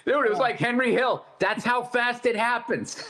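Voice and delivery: loud, laughing through the words; cracking up while belting the punchline; laughing shout. Real, not acted. Telling co-hosts the punchline, shouting through laughter.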